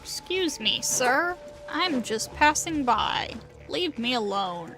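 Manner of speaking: usual speaking voice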